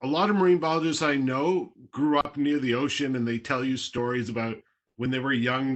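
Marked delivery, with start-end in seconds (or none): none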